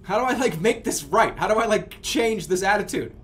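loud, high, worked-up pleading; bad attitude. Real, not acted. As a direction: demand a fix for your own foul mood. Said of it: "I sound like an attitude boy".

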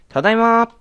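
a big, high-pitched "ただいまー" called into the house; loud homecoming call. Pretend. Player calling "I'm home" into a sleeping house, loud and high. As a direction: call out "I'm home" far too loudly. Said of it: "俺の声がでかいだけだ".

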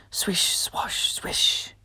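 breathy whisper of swish, swash, swish; whispered tail swish. Acted. Reading to children, whispering the tail sound.

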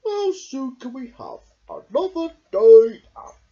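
loud, high excited bear; squeaky cheerful shout. Acted. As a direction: call out happily. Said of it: "the bear shouted out".

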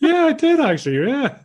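high, loud mock-surprised admission; amused realisation. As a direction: act out a delighted reader owning up, pitched right up. Acting out amused reader's sudden realisation.

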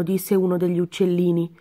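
ordinary delivery, nothing marked

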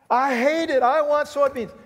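hammed-up tantrum, high and loud; crying farmer. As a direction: wail it like a mock-miserable farmer. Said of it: "and he's crying".

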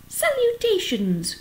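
very high, slow greeting; thin voice. A narrator's reading. Unseen creature replying to Wilbur, in a thin voice. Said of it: "replied the voice".

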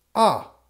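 loud, high sharp yelp of pain; cry of pain. Acted. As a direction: yelp as the wood hits. Wood strikes Geppetto's shins.

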